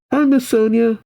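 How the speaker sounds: high, friendly hail; calling out. Acted. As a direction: call a greeting to someone nearby. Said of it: "Marvin calls out".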